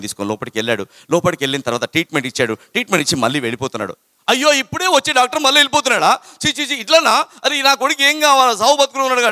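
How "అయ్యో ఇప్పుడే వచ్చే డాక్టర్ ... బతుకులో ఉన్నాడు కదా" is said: high, loud, desperate cry of a father; panicked father. Retold or imitated. Father sees the doctor leaving, high and loud in panic. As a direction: plead as the doctor walks away, voice up high.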